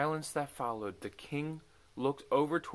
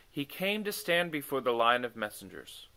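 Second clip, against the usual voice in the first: ordinary delivery, nothing marked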